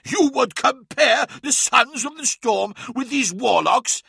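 booming mocking challenge. A narrator's reading. Russ mocking Lorgar's comparison, booming with riotous amusement. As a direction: boom it out like a laughing giant. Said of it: "Russ threw back his head and laughed".

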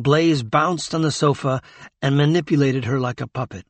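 plain reading voice

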